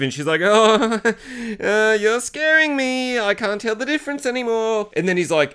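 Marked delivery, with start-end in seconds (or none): 0.4-4.9 high, drawn-out pleading voice; mock frightened. Woman grabbed by the aggressive man, mock frightened. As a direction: imitate a scared woman, hammed up.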